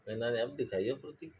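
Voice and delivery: usual speaking voice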